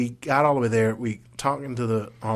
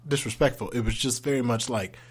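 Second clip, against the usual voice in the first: usual speaking voice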